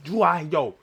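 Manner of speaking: ordinary talk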